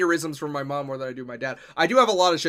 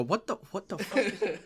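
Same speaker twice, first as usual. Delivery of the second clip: confused what the; 5 semitones higher, 7 dB quieter, slower than usual. Retold or imitated. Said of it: "they go".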